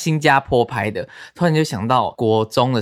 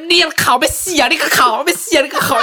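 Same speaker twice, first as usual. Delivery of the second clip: high mock angry shout at a child; hammed-up furious mom scolding; imitating mom yelling; 14 semitones higher, 4 dB louder than usual. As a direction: bark it like a panicking mother. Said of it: "她说".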